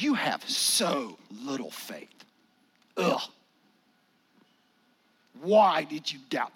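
slow, disgusted, with a scoffing ugh; scornful and let down, drawn out; disgusted Savior. Retold or imitated. Disgusted Jesus scolding Peter, slow and scornful. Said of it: "I hear a disgusted Savior".

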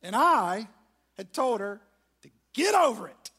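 preacher's loud self-rebuke; loud confession. Real, not acted. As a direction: belt out the shameful admission. Confessing his shameful reaction.

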